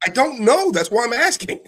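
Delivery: loud, high-pitched exasperation; frustrated and pushed high; exasperated retort. Real, not acted. Exasperated retort in heated argument.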